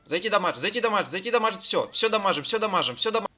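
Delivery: loud, high raid calls; shouted commands. Real, not acted. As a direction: bark the attack orders. Raid leader calling attacks.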